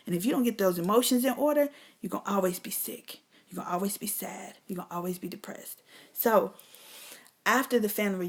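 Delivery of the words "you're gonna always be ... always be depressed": fading warning. Acted. Each line quieter.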